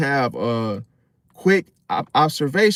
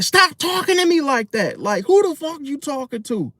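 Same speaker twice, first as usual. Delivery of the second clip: much higher, indignant put-on woman's voice; mock angry girlfriend; 11 semitones higher than usual. Girlfriend going off at her man over an insult, high mock outrage. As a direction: play her going off, pitched way up. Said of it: "and she gonna be like".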